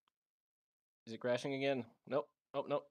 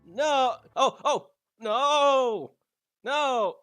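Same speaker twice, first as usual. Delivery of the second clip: loud panicked yells; shouting no; 8 semitones higher, 10 dB louder, much slower than usual. Real, not acted. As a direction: yell no over and over. Game glitch, shouting no.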